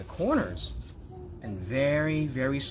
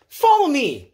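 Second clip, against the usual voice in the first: much higher, louder cheerful order; brisk command; 11 semitones higher, 8 dB louder than usual. Acted. Mister Happy orders Mister Miserable, high and loud. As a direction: call it out decisively.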